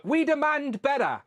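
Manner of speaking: slow, high mocking voice of angry commenters; mock outraged demand. Streamer mocking angry commenters, slow high mock outrage. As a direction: lampoon the outraged fans, drawn out and high. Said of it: "people want me to be outraged on their behalf".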